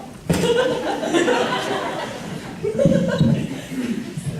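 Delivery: high giggling fit; burst of laughter. Real, not acted.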